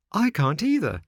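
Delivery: high, distressed exclamation; sudden high-voiced panic; alarmed cry. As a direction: cry out in dismay, pitched well up. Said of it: "cried Pinocchio".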